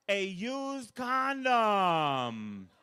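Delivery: very slow showman's reveal; drawn-out reveal. Acted. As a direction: stretch the words out for the punchline.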